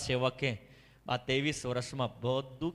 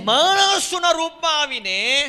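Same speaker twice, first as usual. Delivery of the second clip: much higher, far louder verse echoes; preaching at full force; 9 semitones higher, 12 dB louder than usual. Real, not acted. Preacher echoing the scripture to the church, at full force. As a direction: thunder each line back to the church.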